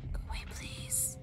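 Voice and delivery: unvoiced hushed whisper. Real, not acted. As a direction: whisper so babies stay asleep. Near sleeping babies.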